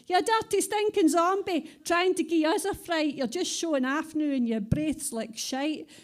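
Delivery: hammed-up drunken rant fading out; drunk man shouting. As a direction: shout it at the zombie like a furious wee drunk.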